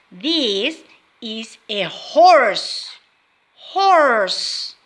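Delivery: slow, careful model sentence; slow teacher modelling. Shown as a demonstration. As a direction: spell it out slowly for young learners. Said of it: "Can you repeat?".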